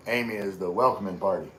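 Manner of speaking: ordinary talk, nothing marked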